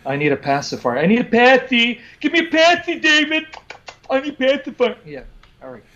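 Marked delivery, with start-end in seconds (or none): 1.0-4.9 put-on high whiny baby voice, much higher and louder; mocking baby whine. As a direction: whimper for a pacifier like a toddler. Mocks opponent as a baby.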